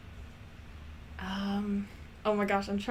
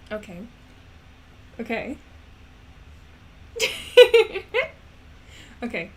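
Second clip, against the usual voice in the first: ordinary talk, nothing marked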